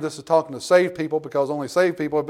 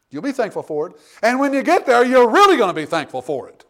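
much higher, louder preaching cry; fiery preaching; 6 semitones higher, 7 dB louder than usual. Acted. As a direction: build to a shouted exhortation. Preaching at full force.

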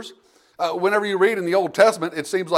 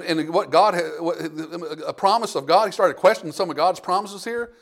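ordinary delivery

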